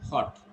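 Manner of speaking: "Hot" with a brief o; short vowel. Shown as a demonstration. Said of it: "this is short A".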